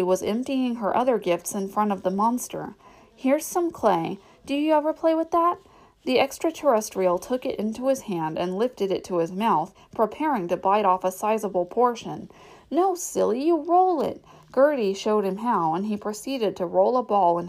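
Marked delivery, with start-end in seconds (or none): 3.1-5.6 put-on 1 little girl voice. A narrator's reading. 12.7-14.2 put-on 1 little girl voice. A narrator's reading. Gertie voiced.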